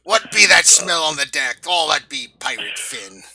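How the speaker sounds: loud pirate bellow. Pretend. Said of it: "in pirate voices".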